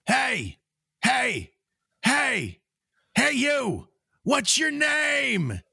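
high, long-drawn holler; imitated far-off yell, very high-pitched; distant dog calling out. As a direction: shout across a whole neighborhood. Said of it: "the dog in the distance goes".

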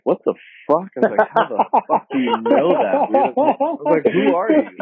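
high, laughing retold shock; mock shocked disbelief. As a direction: squeal it out in stunned disbelief. Retelling his shocked reaction.

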